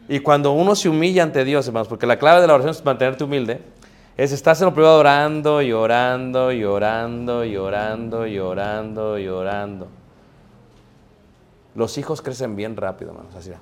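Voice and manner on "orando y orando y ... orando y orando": droning repetition. Shown as a demonstration. Preacher showing believers praying on and on in private, droning and fading. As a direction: let each repeat run on and die away.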